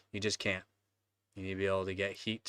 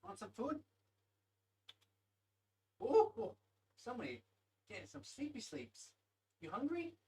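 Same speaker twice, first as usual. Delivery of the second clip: talking to a pet; 12 semitones higher, 7 dB louder than usual. Real, not acted. Cooing to her cat Nugget.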